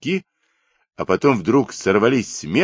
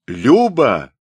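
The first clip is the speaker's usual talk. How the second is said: loud, higher shout of approval; crowd bellow; 6 semitones higher, 6 dB louder than usual. A narrator's reading. Cossacks approving the runaway, bellowing. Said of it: "гаркнули все на Майдане".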